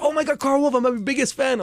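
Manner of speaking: excited fan. Retold or imitated. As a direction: squeal it like a starstruck fan. Imitating an excited fan meeting him.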